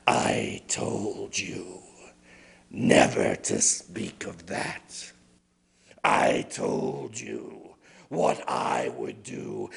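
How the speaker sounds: a cold snow spirit warning him; menacing spirit. Acted. Snow woman threatens the woodman.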